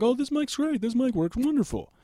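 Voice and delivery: high, hammed-up delight over the mic; mock gushing fan. Naive singer praising his mic, in hammed-up high delight. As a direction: gush like a naive singer thrilled with it. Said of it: "I'd be like".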